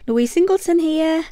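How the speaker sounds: loud, lifted, thrilled greeting to viewers; real excitement. Real, not acted. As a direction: speak up, bursting with excitement. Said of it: "Can you tell I'm excited by my voice".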